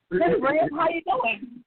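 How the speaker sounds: high, cheery mock greeting from a grinning dad; overly friendly hello from her friend's dad; teasing dad greeting. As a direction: chirp it out like a dad who is teasing. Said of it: "his dad was teasing ear to ear".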